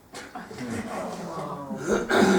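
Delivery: a short real laugh at the story. Real, not acted. Laughs after child's quote.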